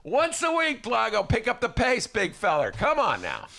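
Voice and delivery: high, loud hammed-up coach; pushy coach voice. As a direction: bark at him like a coach.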